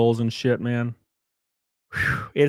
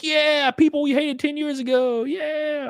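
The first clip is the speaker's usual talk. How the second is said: high, mocking cheer; sarcastic high-pitched celebration; mock excited fan; 14 semitones higher than usual. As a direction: ham up a giddy supporter. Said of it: "They were like".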